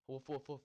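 lightly barked woofs; mock dog bark. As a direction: play the puppy barking, half-joking. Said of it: "Probably gonna be barking soon".

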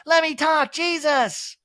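high, loud exasperated outburst; Kyle snapping. Retold or imitated. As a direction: shout her down mid-fight. Kyle's kitchen argument flashback.

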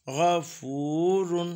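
गफूरुन with a prolonged "fooo"; slow, stretched ū in गफूरुन; full long vāv. Shown as a demonstration. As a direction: hold the middle ū of गफूरुन, then "run". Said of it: "पूरे वाव की आवाज़ बनेगी".

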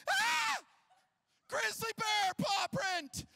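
high, slow, shrieking fake panic; screaming like a girl. Dad pranking the family about the bear, screaming in fake terror. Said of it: "I start screaming like a girl".

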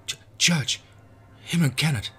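high, loud frightened stammer. Acted. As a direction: stammer in terror. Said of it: "the boy stammered".

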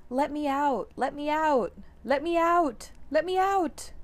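from a loud plea to a high shout; building panic. Acted. Cat panics in the car.

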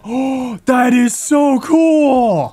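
loud, high and slow with awe; drawn-out high delighted yell; delighted shout. Real, not acted. Finds amazing storage item.

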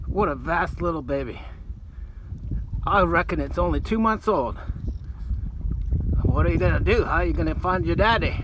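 Aussie croc hunter host voice; nature documentary. Pretend. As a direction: narrate like an excited wildlife presenter. Steve Irwin style wildlife host bit.

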